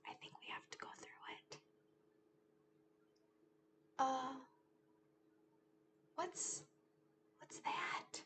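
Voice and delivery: mock-scared whisper; spooky whisper. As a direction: hush the kids as if something lurks. Entering dark cave.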